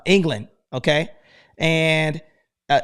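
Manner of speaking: ordinary talk, nothing marked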